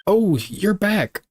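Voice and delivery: high, slow and delighted; happy girl voice. Acted. Maya greeting her returning lawyer, high and slow. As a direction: sing it up high like a cheerful girl.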